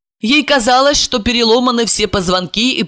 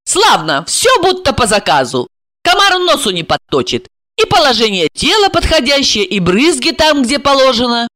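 higher, pleased running tally; brisk approval in a raised pitch; satisfied exclamation; 7 semitones higher than usual. A narrator's reading. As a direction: tick off each success with approval. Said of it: "воскликнула Марина таким тоном".

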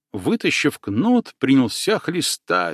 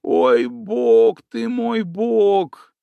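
high, slow whimpering moan; whining man; 8 semitones higher, much slower than usual. Acted. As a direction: moan like a coward in pain. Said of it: "снова заныл Карасёв".

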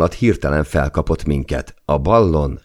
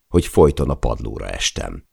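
plain reading voice

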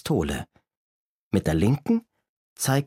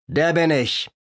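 boastful robber; 6 semitones higher than usual. A narrator's reading. Robber proudly names himself.

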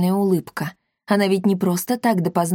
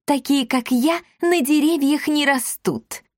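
haughty; 8 semitones higher than usual. A narrator's reading. Wife boasting to husband.

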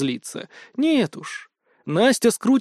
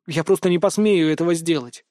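ordinary delivery, nothing marked

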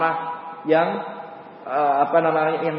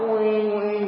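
imitated mosquito buzz, drawn out; long droning mosquito noise; mosquito whine; 4 semitones higher than usual.

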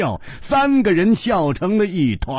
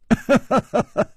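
teasing chuckle; 6 semitones higher than usual. Acted. Chu teases Hu about princess.

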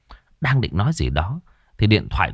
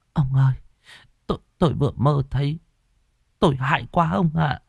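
panting fright; 7 semitones higher, faster than usual. A narrator's reading. Wife waking from a nightmare tells her husband, frightened. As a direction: stammer it out, shaken from a bad dream. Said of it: "thở hồng hộc".

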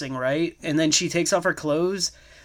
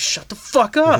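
angry retort; 7 semitones higher than usual. Retold or imitated. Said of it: "And he's like".

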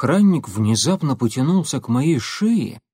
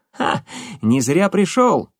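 pleased, slow gloating exclaim; triumphant; 6 semitones higher, slower than usual. Acted. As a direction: crow about finding him.

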